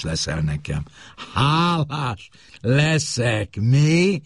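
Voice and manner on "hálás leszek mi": slow, high scornful echo; mocking repeat. Husband mockingly repeats his wife's words, scornful. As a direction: throw her words back at her. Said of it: "ismételt [name]".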